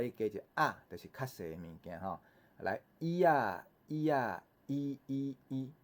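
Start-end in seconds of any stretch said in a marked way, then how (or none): 3.0-5.7 drawn-out model reading for repetition; teacher's reading drill. Shown as a demonstration. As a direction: lead the class in slow, even recitation. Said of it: "咱做伙来念一下".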